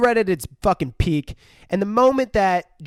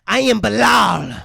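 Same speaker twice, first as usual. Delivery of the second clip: possessed demon voice; 4 semitones higher, 4 dB louder than usual. Acted. Possessed girl declaring she is Belial, loud demon voice. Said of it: "she's changing voices throughout this".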